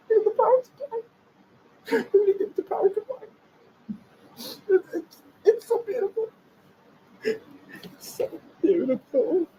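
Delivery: high hammed-up sobbing voice; imitating a hater weeping over the build; mock ugly cry. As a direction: cry the words out like a moved fanboy. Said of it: "ugly cry".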